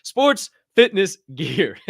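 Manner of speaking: "Sports fitness gear" said slowly with a laugh in it; laughing correction. Real, not acted. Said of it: "Hey, spitness".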